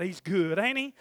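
ordinary talk

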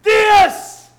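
high, loud, slow taunting shout; flesh goading. Retold or imitated. Preacher voicing the flesh goading the listener, shouted. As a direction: bark the flesh's regret. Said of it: "your flesh is saying".

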